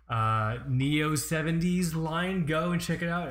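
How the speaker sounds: normal talking voice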